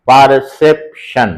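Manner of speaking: "পারসেপশন" very slow, par-sep-shan; syllable split. Shown as a demonstration. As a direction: pause between every syllable of "পারসেপশন". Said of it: "ইংরেজি সিলেবলের মতো".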